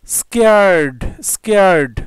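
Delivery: teacher modelling স্কেয়ার্ড; model pronunciation. Shown as a demonstration. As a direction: call out স্কেয়ার্ড twice for the class to copy. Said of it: "উচ্চারণগুলো জেনে নেব".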